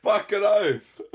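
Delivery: high, loud laughing words. Real, not acted. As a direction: squeal it through laughter. Cracking up at the joke.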